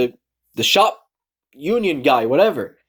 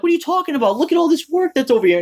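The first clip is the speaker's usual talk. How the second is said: high-pitched retold disbelief; exasperated, high-voiced protest; incredulous retort; 12 semitones higher, faster than usual. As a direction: argue back incredulously, pitch jumping up.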